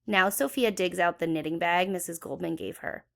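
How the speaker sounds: usual speaking voice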